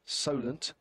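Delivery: normal talking voice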